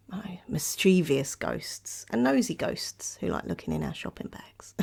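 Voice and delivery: hushed, conspiratorial whisper. Acted. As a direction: whisper it like a secret. Secretive whisper about nosy ghosts.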